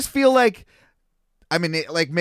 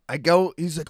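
ordinary talk, nothing marked

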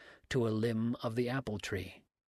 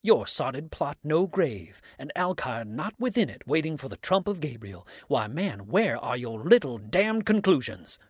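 loud, high, swooping mockery; exclaimed derision; 3 semitones higher, 6 dB louder than usual. A narrator's reading. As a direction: jeer at the accuser's theory. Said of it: "cried Dix".

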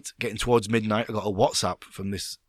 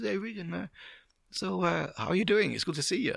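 imitating the calm Swiss boss, higher; a light take on a calm boss greeting you; 9 semitones higher, 4 dB quieter than usual. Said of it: "He was very calm".

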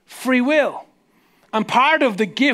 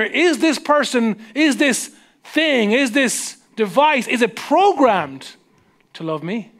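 slow; slower than usual. Acted. Slow wondering rhetorical questions.

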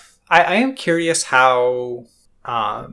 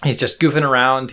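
usual speaking voice

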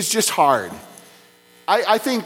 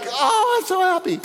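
put-on much higher, bright child voice, overjoyed; mock kid gushing with joy; imitating a happy kid; 10 semitones higher than usual. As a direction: squeal it like a thrilled little boy.

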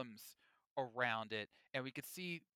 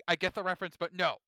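ordinary talk

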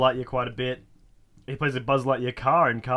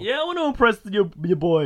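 mock eager voice; 9 semitones higher, 3 dB louder than usual. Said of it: "I'm gonna impress the girl".

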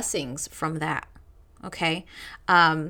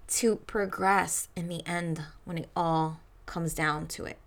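ordinary talk

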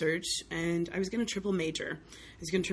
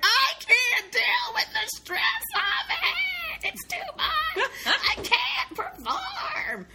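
hammed-up wailing, much higher and very slow; melodramatic meltdown; 4 dB louder, much slower than usual. Teen actor melting down before finals, mock wailing. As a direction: overplay a teen drama queen sobbing.

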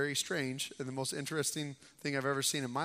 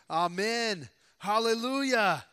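Amen first, then hallelujah lifted higher and louder; cry of worship; 4 semitones higher, 6 dB louder, slower than usual. A narrator's reading. Elders falling down in worship, rising cry of praise. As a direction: build into a ringing hallelujah.

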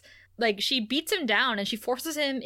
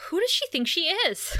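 pitched way up in playful outrage; indignant, high-pitched huff; mock indignation; 7 semitones higher than usual. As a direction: scoff at her nerve, high and huffy.

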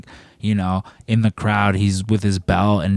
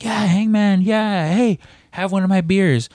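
pitched way up, hyped fan calling to a wrestler; excited crowd cheer; 11 semitones higher than usual. Retold or imitated. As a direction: call out to him like a thrilled fan.

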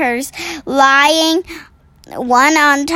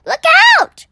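sharp warning cry, much higher and louder; alarmed cry; 5 dB louder than usual. Acted. The BFG warning Sophie the giant is coming, loud cry. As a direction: yell a sudden warning. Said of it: "cried the B F G".

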